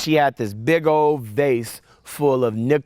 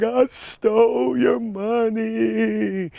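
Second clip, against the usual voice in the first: put-on high, slow, tearful child's voice; crying confession; 10 semitones higher, much slower than usual. Boy confesses on the phone to grandmother, slow and tearful. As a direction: drag it out like a sobbing kid owning up. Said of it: "And I'm crying".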